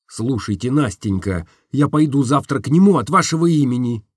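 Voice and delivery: ordinary delivery, nothing marked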